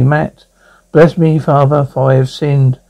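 ordinary delivery, nothing marked

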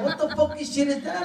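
high, laughing indignation; can't believe the rejection; high laughing outrage; outraged disbelief. Retold or imitated. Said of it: "I'm like".

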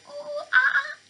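high, far-off monkey hoots; monkey call. Acted. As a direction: hoot it out like a playful monkey. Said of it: "Does an elephant say".

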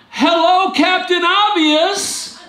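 high, slow, laughing sarcasm; mock the obvious complaint; sarcastic. Real, not acted. Mocking the religious leaders.

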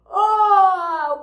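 long, loud, high "oh"; big playful wake-up holler; waking-up cry. Pretend. Teacher acting out waking from hibernation, loud long oh.

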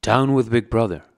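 ordinary delivery, nothing marked

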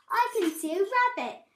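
high, loud mouse voice; excited cry. A narrator's reading. Mouse spots a shape in clouds, excited cry. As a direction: cry out the discovery. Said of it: "cried Mouse".

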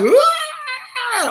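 crazy yell. Retold or imitated. Dad reacting to his son's hype, crazy yelp. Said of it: "like a crazy person".

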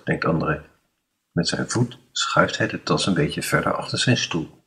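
ordinary delivery

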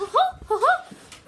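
cartoon mouse giggle; high squeaky laugh. Laughing in the Minnie voice.

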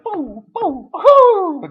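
high, floating "puu puu huu" toots; mimicked bird hoots. Mocking the calm bird's flight, high hooting. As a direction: imitate the calm bird drifting along. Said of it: "diye uçan bir kuş".

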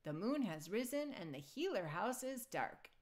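ordinary delivery, nothing marked

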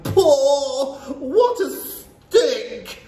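high, drawn-out cries of revulsion; disgusted cries. A narrator's reading. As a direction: cry out at an unbearable stink. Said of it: "there were cries of".

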